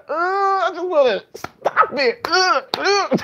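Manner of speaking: squeaky imitation of a cringing viewer; mock pained viewer. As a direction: whine like you can't bear to watch. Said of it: "I'm just sitting there like".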